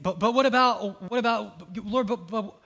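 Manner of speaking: hurried, stammering mock excuses. Believer stammering excuses to God, mildly flustered. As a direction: stumble over the objection. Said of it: "we're trying to finish the sentence".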